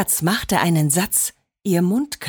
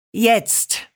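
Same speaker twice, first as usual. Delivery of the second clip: ein kurzes, ernstes Jetzt; entsetztes, strenges Jetzt; 3 semitones higher than usual. A narrator's reading. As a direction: lass keinen Widerspruch zu. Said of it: "rief Papa sichtlich entsetzt". (Vater ruft die Kinder in die Küche, knapp und ernst)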